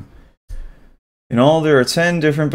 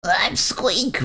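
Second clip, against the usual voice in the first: high, softer, timid little creature voice; nervous squeaky creature; 11 semitones higher, 5 dB quieter than usual. Acted. Blue creature introduces itself to Bubble, high and timid. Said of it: "replied the blue creature nervously".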